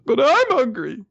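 put-on whiny child. Small Yamato telling the samurai he is hungry, high whine. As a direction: whine it like a starving little kid. Little child complaining of hunger.

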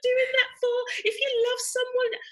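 high, imitating a tearful plea to the kids; mock crying plea. Mum retells pleading with her fighting kids, mock crying plea. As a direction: replay begging the kids through tears. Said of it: "I'm, like, crying, going".

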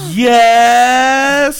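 thrilled shout; excited. Real, not acted. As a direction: gasp then shouted yes. Excited at dune buggy parts.

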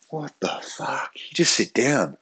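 hushed, slow, disbelieving; quiet disbelief, dragged out; stunned hush. Retold or imitated. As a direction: mutter it under your breath in shock. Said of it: "I'm going".

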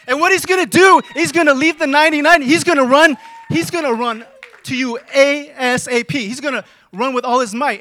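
loud, high, driving sermon delivery; full-force preaching. Acted. Pastor urging the congregation, preaching at full force. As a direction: preach it out with fire.

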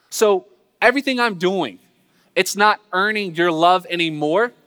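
usual speaking voice

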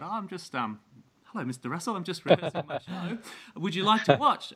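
high, bright-eyed nervous gush, laughing by the end; a starstruck youngster tripping over his words; nervous eager kid. Retold or imitated. Young performer to [name], high nervous excitement.

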